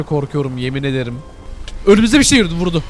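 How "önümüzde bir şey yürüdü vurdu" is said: loud, high shout of alarm; real alarm, loud and urgent. Real, not acted. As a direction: yell as it strikes you. Something attacks in front.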